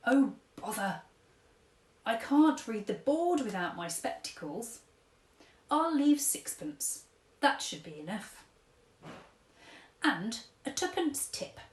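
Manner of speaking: lightly voiced fussy old gent; old-fashioned gentleman. Old tramp paying at café, lightly voiced fussy gent. Mister Stink in coffee shop.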